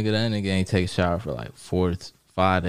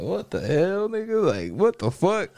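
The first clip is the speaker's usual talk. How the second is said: much higher, startled cry; shocked outburst; 10 semitones higher, slower than usual. Retold or imitated. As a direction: jump up in pitch in disbelief. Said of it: "I'm like".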